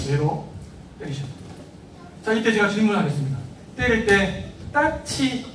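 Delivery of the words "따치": mock playful slap noise, higher with a laugh; light tap sound. Shown as a demonstration. Teacher mimicking a weak slap, playful. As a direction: tap it out teasingly. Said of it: "이렇게 때려야 됩니까".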